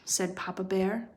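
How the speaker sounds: usual speaking voice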